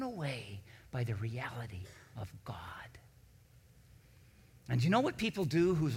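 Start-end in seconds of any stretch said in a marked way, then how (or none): 0.0-2.6 awed hush, breathed without voice; whisper. Real, not acted. Pastor to congregation, awestruck about God's reality, whispered. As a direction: whisper it as if overwhelmed by wonder.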